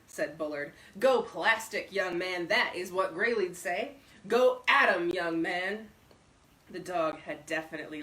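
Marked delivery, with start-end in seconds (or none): 1.0-5.9 a hint of a loud, boastful old man; gruff old braggart. A narrator's reading. Bullard urging the stranger.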